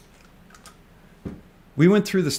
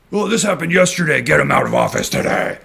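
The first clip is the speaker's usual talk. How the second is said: mocking angry investors; 5 dB louder than usual. Mocking investors who want the regulator fired, hammed-up outrage. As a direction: parody the hotheads demanding a firing.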